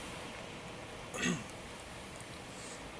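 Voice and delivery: hard choking cough; coughing on a bug; just inhaled a bug. Real, not acted. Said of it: "I think I just inhaled a bug".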